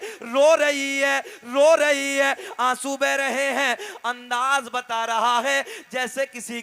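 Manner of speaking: fervent, drawn-out preaching delivery. Acted. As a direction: preach the scene to the crowd with feeling. Preacher narrating a naat story. (जोशीला वाअज़ का अंदाज़)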